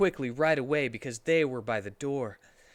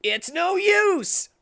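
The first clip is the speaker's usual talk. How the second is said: very high, loud, slow drawn-out yell; dramatic shout; 9 dB louder, much slower than usual. Acted. Shadow shouting from the back of the audience, slow high yell. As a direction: stretch it out like a cartoon battle cry.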